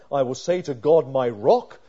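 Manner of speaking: first reading, loud and bold; proclaimed verse. Acted. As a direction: call it out boldly.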